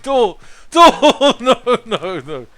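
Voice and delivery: high, loud hammed-up wail; mock dying wail. Player acting out a foe's demise, mock dying wail. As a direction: wail comically, trailing into laughter. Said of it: "He's like".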